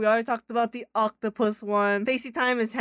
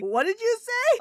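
mock shocked; 13 semitones higher than usual. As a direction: jump up in disbelief. Acting out shocked reaction.

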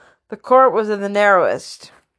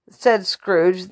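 plain reading voice

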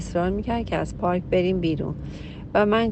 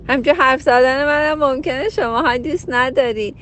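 higher and louder, speaking with fervour; passionate raised voice; 5 semitones higher, 4 dB louder than usual. Real, not acted. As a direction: push the point with a raised voice.

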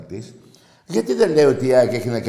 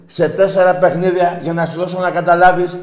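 high, raised voice hammering each point; loud emphatic insistence; 6 semitones higher, 7 dB louder than usual. Real, not acted. Host convincing a doubting fan with results, loud insistence. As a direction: drive it home at full volume.

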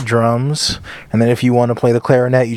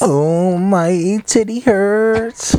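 high, very drawn-out comic moan; mock wailing complaint; 10 semitones higher, much slower than usual. Cohost joins the joke about himself, slow high mock wail. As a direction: stretch it into a long, high fake whine. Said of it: "Anything that hurts him, he's like".

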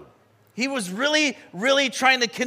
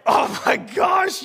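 high, slow, drawn-out exclaim; amazed disbelief; 10 semitones higher, 4 dB louder, slower than usual. Retold or imitated. Driver reacting to the angry man's red-light rant, loud slow disbelief. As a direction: stretch it out in loud, stunned amusement. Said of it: "And I'm like".

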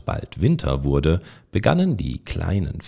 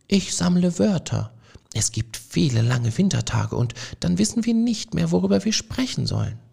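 higher, gentle mouse voice, quickening at the end; dreamy mouse Frederik; 12 semitones higher than usual. A narrator's reading. Frederik tells the mice he gathers words, in a higher gentle voice.

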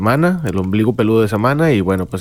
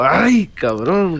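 slow drawn-out exclamation; 6 semitones higher, much slower than usual. Retold or imitated. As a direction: stretch "Ay, cabrón" out very slowly, a bit higher. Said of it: "ahí sí digo".

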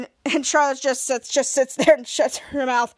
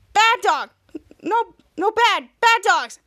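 higher, louder telling-off of a dog; scolding; 5 semitones higher, 4 dB louder, slower than usual. Acted. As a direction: scold the dogs in short bursts. Chris scolds the dogs.

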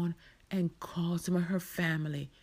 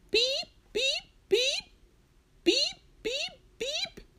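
very high, piercing electronic beeps; imitated beeping; 4 dB louder, slower than usual. Storyteller voicing the noise Marcia hears, high beeping. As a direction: mimic an alarm in groups of three. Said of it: "where that noise coming from".

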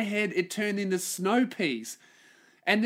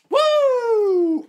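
high, loud "woo"; loud whoop; 9 dB louder than usual. Real, not acted. Podcaster just took a hit of the asthma inhaler, loud whoop. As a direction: let out a sustained high whoop.

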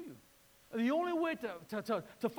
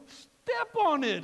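exasperated order; 9 semitones higher, 8 dB louder, much slower than usual. Retold or imitated. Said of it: "I go".

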